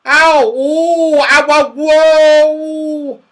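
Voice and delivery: high, loud howls of pain; yelling in pain. A narrator's reading. Saucepan Man out of sight above, howling high. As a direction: wail "ow" and "whoa" at full voice. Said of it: "he began to yell".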